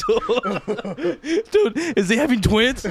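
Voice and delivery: high, loud, helpless laughter through words; real laughing fit while talking. Real, not acted. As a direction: crack up while getting the joke out. Cracking up while joking about screams.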